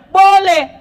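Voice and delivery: high loud exclaimed answer; emphatic answer. Real, not acted. Preacher answers congregation's question, high and loud.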